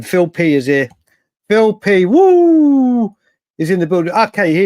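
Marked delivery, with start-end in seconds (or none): 1.5-3.1 excited woo. Real, not acted. Greets a viewer arriving.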